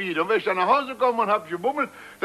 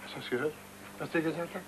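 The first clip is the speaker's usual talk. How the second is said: sidéré, à voix basse; 6 semitones lower, 12 dB quieter, much faster than usual. Acted. Vater fassungslos nach Beleidigung.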